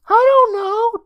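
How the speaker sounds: put-on high, loud boyish voice, unsure. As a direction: shrug it off in a kid voice. Boy unsure in cellar.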